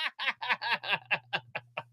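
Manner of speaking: soft incredulous chuckle; disbelieving laugh. Real, not acted. Vlogger mocking Biden's speech, laughing softly. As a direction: laugh it off quietly.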